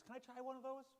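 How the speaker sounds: a stranger eager to taste a chip; eager passerby. Retold or imitated. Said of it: "He goes".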